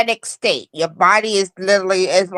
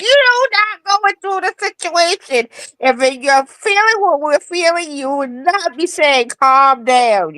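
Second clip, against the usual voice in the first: high-pitched, heated protest; worked-up re-enacted retort; indignant comeback; 9 semitones higher than usual. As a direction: argue back in exasperation, pitch raised throughout. Acted indignant reply to advice.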